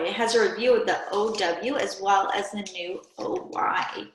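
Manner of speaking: ordinary delivery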